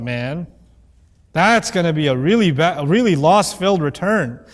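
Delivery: low, mocking mock dread; sarcastic. Deniers joking about a losing return, low sarcastic voice. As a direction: drag it out as a joke. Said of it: "making this statement sarcastically".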